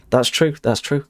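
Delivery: ordinary talk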